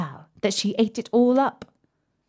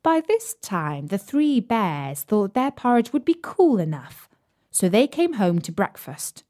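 usual speaking voice

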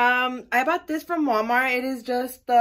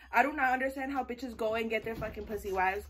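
ordinary talk, nothing marked

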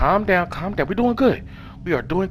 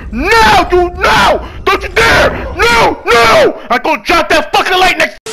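high loud yells of no; real frantic yelling, higher and louder; frantic shouting; 9 semitones higher, 5 dB louder, slower than usual. Real, not acted. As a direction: scream to stop it. Enemy about to catch him.

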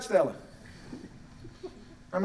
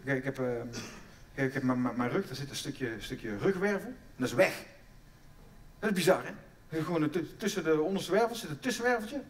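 normal talking voice

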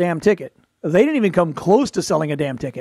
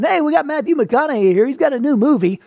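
higher, perky talk show host voice; chirpy morning show host; 7 semitones higher than usual. Retold or imitated. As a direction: play a bubbly morning TV host. Mock morning show host chat.

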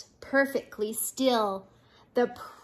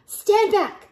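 loud, raised order; booming command; 9 semitones higher, 7 dB louder than usual. Acted. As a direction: boom it out over the crowd. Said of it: "boomed a voice".

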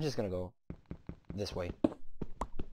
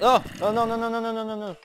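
high startled string of no's; scared; 10 semitones higher, 3 dB louder, much faster than usual. Real, not acted. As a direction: panic at a sudden scare. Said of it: "that scared me".

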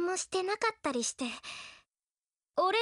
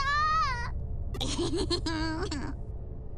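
relieved laughing sob. Acted.

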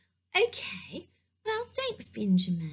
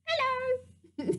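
high-pitched puppet piglet voice; Pinky Pig; 11 semitones higher than usual. Pretend. Pig puppet greeting the storyteller, high piglet voice. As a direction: greet them in a tiny high character voice. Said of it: "Hi, Pinky Pig".

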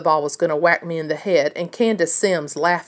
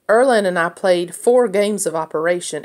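plain reading voice

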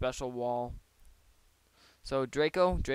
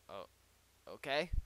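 usual speaking voice

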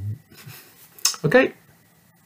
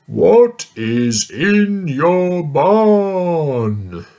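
slow, loud, higher giant bellow; mean giant yelling at the little girl; 8 semitones higher, 8 dB louder, much slower than usual. Acted. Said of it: "yelled the mean giant".

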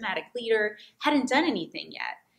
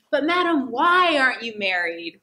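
cheeky student question; 6 semitones higher, 7 dB louder than usual. Retold or imitated. Students quizzing the mock teacher.

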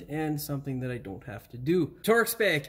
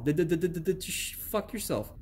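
stutter; slower than usual. Pretend. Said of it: "sound like I have a stuttering issue".

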